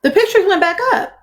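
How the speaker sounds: laughing disbelief. Real, not acted. Repeated with a laugh.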